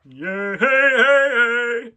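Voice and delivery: shouted greeting echoing in a cave; calling out. Real, not acted. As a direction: call out loudly to friends.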